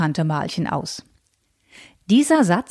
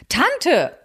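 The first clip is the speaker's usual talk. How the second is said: sharp, higher indignant "Tante"; outraged niece's cry; indignant outcry; 8 semitones higher than usual. Acted. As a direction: call out "Tante" in protest. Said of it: "rief Luise empört".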